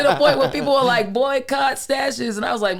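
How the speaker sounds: laughing as he tells it. Real, not acted. Laughing to podcast host about boycott. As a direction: chuckle through the story.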